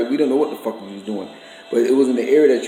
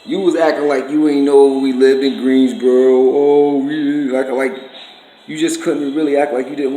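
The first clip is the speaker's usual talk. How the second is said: accusing; 2 semitones higher, 6 dB louder than usual. Real, not acted. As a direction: drag the words out, calling him out. Accusing brother-in-law of pretending.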